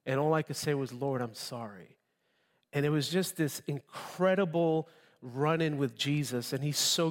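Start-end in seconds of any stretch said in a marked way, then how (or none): 0.9-2.0 soft, broken whisper of an apology; hushed and tearful, as if still under the pew; tearful plea. Retold or imitated. Retelling his conversion to the congregation, softer tearful plea. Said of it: "I was under the pew weeping".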